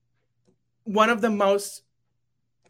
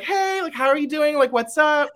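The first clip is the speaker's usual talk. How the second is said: high, bouncy imitation of a relaxed mentor; a mock chirpy what's up; casual greeting; 8 semitones higher, 6 dB louder than usual. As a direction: lightly play her breezy hello. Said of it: "she was just like".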